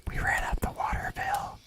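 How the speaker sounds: retold whisper, soft and breathy; imitating her whisper. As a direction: whisper it like a confession. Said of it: "She whispered".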